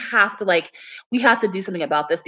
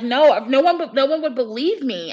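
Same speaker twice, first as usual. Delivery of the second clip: ordinary talk, nothing marked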